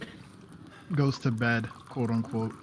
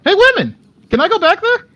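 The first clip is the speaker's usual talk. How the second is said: put-on very high, loud child's shout; kid yelling; 14 dB louder than usual. Kid yelling to the women for permission, high shout. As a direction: holler across the room like a small kid. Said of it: "she's going to yell at the women".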